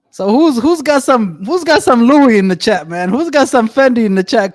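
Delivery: high, energetic call-out to chat; hyped up. Real, not acted. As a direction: pump up the viewers. Hyping up the chat audience.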